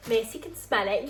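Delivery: normal talking voice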